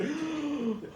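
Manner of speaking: high, audible intake of breath; mock gasp of realisation. Teens realising what they did, mock gasp. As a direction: gasp as if it just dawned.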